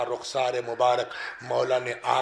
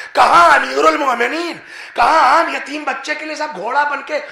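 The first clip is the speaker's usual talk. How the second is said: much higher and louder, soaring sermon climax; preaching at full force; 12 semitones higher, 13 dB louder than usual. Acted. Preacher tells mourners of Qanbar's amazement, full-force preaching. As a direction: lift the hall with rising fervour.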